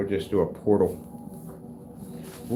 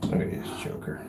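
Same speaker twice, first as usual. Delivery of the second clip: hushed whisper to viewers; 9 dB quieter than usual. Real, not acted. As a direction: whisper so the cat won't notice. Whispering while watching cat.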